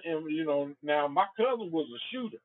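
ordinary delivery, nothing marked

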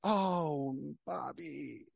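mock sorry sigh on "Bobby"; disappointed sigh. Customer breaking the bad news to Bobby, mock letdown. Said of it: "she's like".

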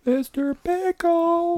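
very high, slow sing-song; high drawn-out. Acted. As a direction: stretch it out way up high. Drawn-out high pet name.

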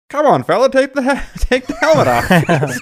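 put-on high, loud old-timey announcer voice; a 1950s newsreel guy calling out. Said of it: "the guy's voice goes".

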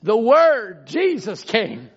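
high, loud shouted preaching; full-force preaching. Real, not acted. Preacher tells congregation Jesus came with an answer, full-force preaching. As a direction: drive each phrase out at a high-pitched shout.

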